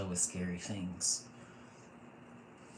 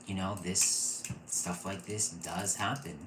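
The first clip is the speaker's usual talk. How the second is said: usual speaking voice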